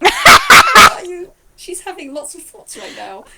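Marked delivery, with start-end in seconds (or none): 0.0-1.3 loud and drawn-out, high disbelieving cry; slow, loud shout of disbelief; shouted question. Acted. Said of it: "and she's just".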